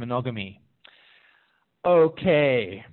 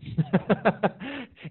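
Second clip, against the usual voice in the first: high chuckling run of laughter; real laugh; 7 semitones higher than usual. Real, not acted. Coach amused by own answer to the question, real chuckling laugh.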